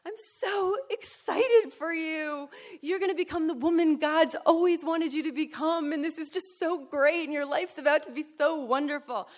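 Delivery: high, loud, gushing excited sponsor voice, laughing. Retold or imitated. Itty-bitty sponsor gushes at newcomer, high squealing excitement. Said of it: "she's like".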